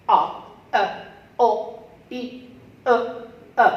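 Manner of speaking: "a, e, o" each short, loud and separate; short vowels. Shown as a demonstration. Teacher shows single short vowels, loud isolated short sounds. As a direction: punch out each vowel as one brief pure sound. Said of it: "single short vowel".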